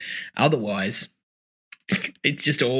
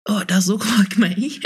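whispered laugh; 5 semitones higher than usual. Retold or imitated. Driver quoted laughing softly.

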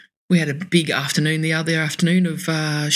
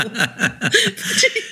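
ordinary delivery

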